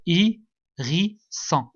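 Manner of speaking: "Hérisson" très lent, découpé en trois syllabes; étire "Hérisson" en séparant chaque syllabe par une pause. Shown as a demonstration. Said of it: "La première syllabe ou bien la deuxième". ("Hé... ri... sson", pour faire compter l'élève)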